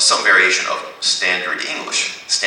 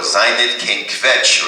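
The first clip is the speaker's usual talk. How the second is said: slow deliberate; 5 semitones higher, 4 dB louder, much slower than usual. Shown as a demonstration. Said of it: "teacher models Yiddish phrase".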